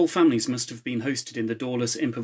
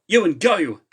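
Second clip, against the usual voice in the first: sharp high yell, much louder; 8 semitones higher, 8 dB louder, slower than usual. A narrator's reading. Charlie yelling at Ewan to go, loud and high. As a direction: yell at Ewan to move now. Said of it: "yelled Charlie".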